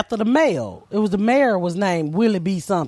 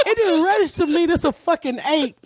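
usual speaking voice

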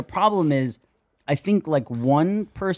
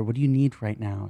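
mock tender concern; 6 semitones lower, 6 dB quieter than usual. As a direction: lower and softer, syrupy caring tone. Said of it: "turn to the other girl and go".

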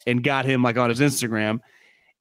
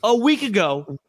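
higher mock-serious sarcasm; sarcastic; 8 semitones higher than usual. Real, not acted. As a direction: play it up with fake gravity. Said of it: "We're being sarcastic".

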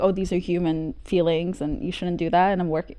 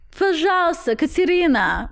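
higher-pitched mother pleading in Russian; put-on Russian-speaking mother; imitating mom; 8 semitones higher than usual. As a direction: imitate a mom appealing to her daughter. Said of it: "I had to force my mom".

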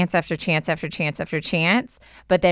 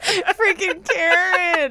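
laughing; 10 semitones higher, much slower than usual. Real, not acted. Laughing at mispronounced name.

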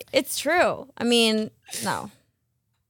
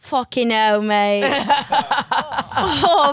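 laughing mock-British swearing; a cheeky London bloke swearing; mock British accent; slower than usual. Host reacting to a sad question in mock British. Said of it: "that accent really".

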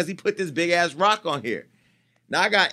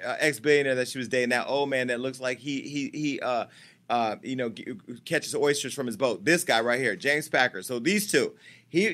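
ordinary talk, nothing marked

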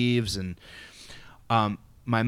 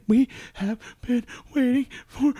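tearful mother; 11 semitones higher than usual. Retold or imitated. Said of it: "tears just start streaming down her eyes".

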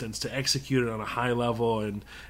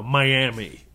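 ordinary delivery

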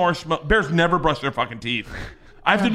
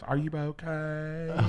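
soft concerned voice; 10 dB quieter, much slower than usual. Retold or imitated. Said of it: "She goes".

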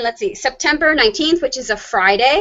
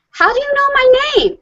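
put-on puzzled child voice; 14 semitones higher, 3 dB louder than usual. Son voiced in story.

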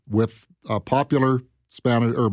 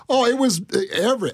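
ordinary talk, nothing marked

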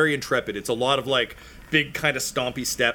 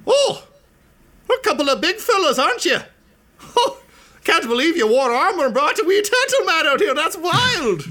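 high, loud chirpy gnome voice, much higher; gnome woman voice; 6 dB louder than usual. Acted. Game master voicing the gnome meeting the party, high and loud. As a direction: pitch it way up as a bubbly little gnome. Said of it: "she's like".

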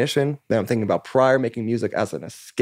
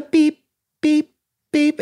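high-pitched beeps imitating the chemo machine; imitated machine beep; 5 dB louder, much slower than usual. Mimicking the beeping chemo machine in the treatment room, high beeps. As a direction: chirp it like a hospital monitor. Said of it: "Fluorescent lights and just, like, the beep".

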